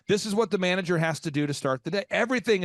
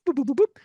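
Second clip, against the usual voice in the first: high beeping boop boop; phone ring beeps; 8 semitones higher, 5 dB louder, faster than usual. Retold or imitated. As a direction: imitate a ringing phone tone. Imitating a phone ringing.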